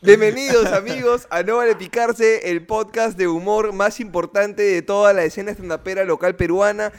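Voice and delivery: loud, upbeat show-opening announcer. Acted. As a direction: welcome listeners like a radio host. Podcast intro announcer read.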